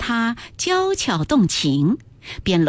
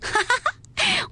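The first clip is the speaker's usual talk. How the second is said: a high slow "ha ha ha"; merry laugh; 3 dB quieter than usual. A narrator's reading. Ping'er laughs at Jia Lian from the window, merry laugh. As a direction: laugh at him from outside. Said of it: "平儿在窗外笑道".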